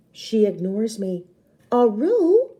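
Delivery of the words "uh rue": firm bossy call, pitched up; bossy, not raised. Acted. Big sister bossing Rue, firm call. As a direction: boss her without shouting. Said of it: "trying to sound bossy without raising my voice".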